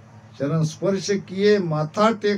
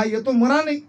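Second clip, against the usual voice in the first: higher-pitched puzzled realisation; acted surprise; 7 semitones higher, faster than usual. Bandits watching the man after his meal, surprised. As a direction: react as if this was not expected.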